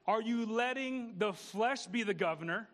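ordinary talk

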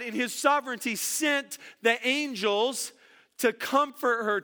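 ordinary delivery

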